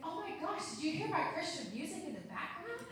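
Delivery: high-pitched, eager question; retold gushing surprise; surprised customer.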